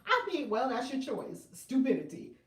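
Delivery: normal talking voice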